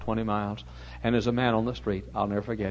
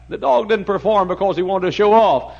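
preaching loudly; 10 semitones higher, 11 dB louder than usual. Acted. Preaching at full force.

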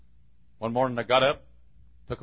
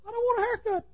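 very high little-dog voice begging; squeaky high dog voice pleading; talking Chihuahua; 7 dB louder than usual. Acted. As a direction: whine to get out like a caged lapdog. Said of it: "it's a male Chihuahua".

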